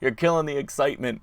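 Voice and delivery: playful complaint. Real, not acted. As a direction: tease them for stalling. Complaining at slow opponent.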